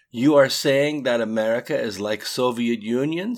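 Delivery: put-on matter-of-fact Russian-accented remark. A narrator's reading. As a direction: state it flatly, Russian-accented. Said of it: "as a matter of fact".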